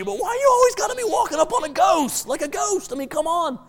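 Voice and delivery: squeaky-high pleading protest; very high, hammed-up grumbling; comic exasperated complaint. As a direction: whine at a friend in mock exasperation. Disciple pulls Jesus aside to complain.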